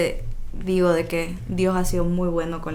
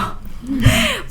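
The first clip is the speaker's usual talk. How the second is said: sudden high giggle; high laugh; 5 dB louder than usual. Real, not acted. Mother laughing at herself, high giggle. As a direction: giggle at yourself.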